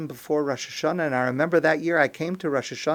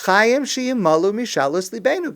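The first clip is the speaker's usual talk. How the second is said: raised, louder Hebrew plea; insistent prayer; 5 semitones higher, 5 dB louder than usual. Shown as a demonstration. Worshippers asking God to grant their wishes, insistently. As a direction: press it as a demand on God. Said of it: "but that's not exactly what we say".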